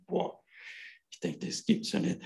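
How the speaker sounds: breathless whispered wonder; hushed awe at the text; amazed whisper. Retold or imitated. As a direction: whisper it in astonishment. Recalls own amazement reading.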